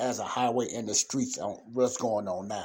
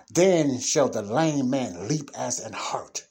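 1 slow scripture recital; 5 dB louder, slower than usual. Real, not acted. Preacher quoting scripture.

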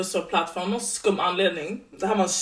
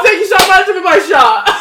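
high and loud, reckless party voice; drunk hype; 10 semitones higher, 13 dB louder than usual. Retold or imitated. Quoting her drunk self at the bar, high loud hype. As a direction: sell the bad idea like it's genius.

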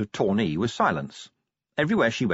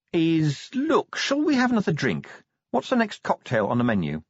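higher, rushed change of subject; evasive; 6 semitones higher than usual. A narrator's reading. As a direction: dodge it brightly. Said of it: "faded in the face of the task".

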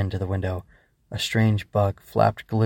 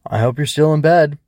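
loud, raised parental call; louder, higher fatherly call-out; 6 semitones higher, 9 dB louder than usual. Acted. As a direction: call into the bedroom as a checking-up dad. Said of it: "her father called in".

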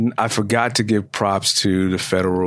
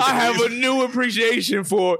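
ordinary talk

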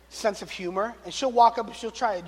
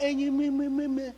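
cooing dad; 5 semitones higher, 4 dB quieter than usual. Retold or imitated. Dad cooing at toddler son.

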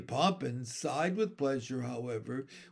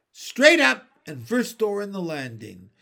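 curt landlady; 5 semitones higher, 9 dB louder than usual. A narrator's reading. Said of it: "said Miss Persimmon".

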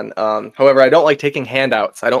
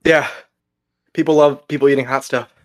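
strained voice from pepper heat; words pushed through a burning mouth; mouth burning from spicy food. Real, not acted. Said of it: "hear it in your voice how hot that is".

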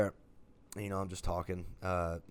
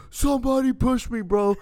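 put-on high, loud, sloppy drunk whine; drunk friend; 9 dB louder than usual. Drunk Alex explaining his fall, high loud slurry whine. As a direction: play the drunk buddy who fell behind the seats.

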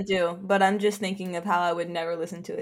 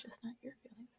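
unvoiced whisper of a blunt retort; whispered teasing put-down; whispered jab; 4 semitones higher, 24 dB quieter, faster than usual. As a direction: drop to a whisper for the punchline.

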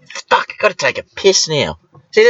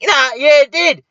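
insisting; 9 semitones higher than usual. Retold or imitated. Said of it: "And I was like".

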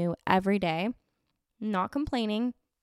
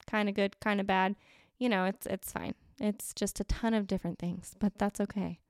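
ordinary talk

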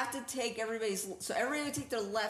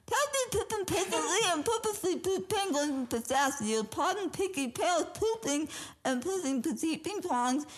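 slurred, tongue held, getting higher; holding tongue; 7 semitones higher than usual. Shown as a demonstration. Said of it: "I gotta hold my tongue".

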